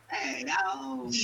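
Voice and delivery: high mock Italian American gangster; hammed-up Italian American exclamation; crappy Italian American accent. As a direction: pitch it up like a cartoon Italian American mobster. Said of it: "I don't know why he's got that accent".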